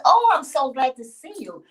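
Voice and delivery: light up at the sight of her; delighted welcome. Acted. Farmer welcoming Yemonja back, loud and delighted.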